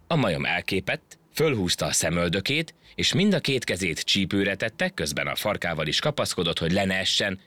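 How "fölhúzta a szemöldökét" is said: usual speaking voice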